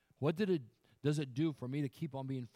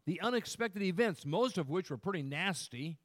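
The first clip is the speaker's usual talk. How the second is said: raised, louder pulpit voice; emphatic preaching; 9 semitones higher, 7 dB louder than usual. Real, not acted. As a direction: drive the point home to the congregation. Preaches at full force.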